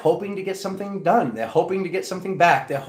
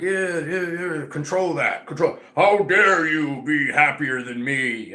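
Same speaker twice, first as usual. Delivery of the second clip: mocking jealous person; slower than usual. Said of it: "And then they're like".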